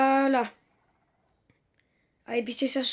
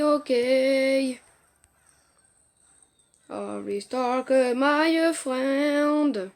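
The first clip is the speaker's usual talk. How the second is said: very slow, drawn-out bad English accent; rotten English accent; much slower than usual. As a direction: drawl terrible French-accented English, holding the last vowel. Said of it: "J'ai un accent pourri". (anglais à l'accent pourri, très étiré)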